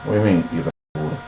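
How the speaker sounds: skeptical listener. Retold or imitated. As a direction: toss it back fast and low, half-mocking the listener. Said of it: "You say".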